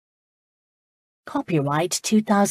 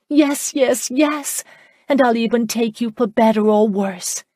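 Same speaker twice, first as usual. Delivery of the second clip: higher, louder joyful yeses tumbling out; bursting yes; 5 semitones higher, 4 dB louder than usual. Acted. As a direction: let the yes burst out, thrilled. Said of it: "The word burst out of her".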